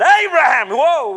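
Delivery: sudden loud cry to stop; urgent call. Acted. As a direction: shout him down mid-strike. Angel calls out to stop.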